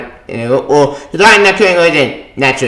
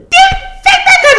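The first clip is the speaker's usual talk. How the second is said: laughing squeal. Real, not acted. Laughing squeal while talking.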